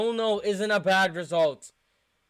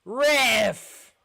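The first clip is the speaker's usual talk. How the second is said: protesting yell; 4 semitones higher, 4 dB louder than usual. Real, not acted. Fan protesting a foul call.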